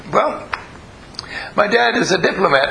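high-pitched imitation of a small child; little kid explaining. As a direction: play a young child proudly explaining. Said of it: "he's only about three years old".

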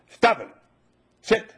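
loud, sharp command to a dog; firm command. Retold or imitated. Owner finally commands the barking dog, loud and firm. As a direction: snap the order like you mean it. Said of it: "you go up to the dog".

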